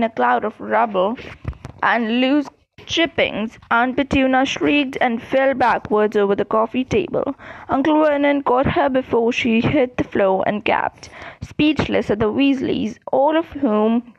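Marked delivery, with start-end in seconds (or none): none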